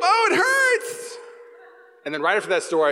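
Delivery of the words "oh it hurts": very high, slow fake whimper; hammed-up pain. Boy faking pain from water, hammed-up whimper. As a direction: overplay being hurt. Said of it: "play it up".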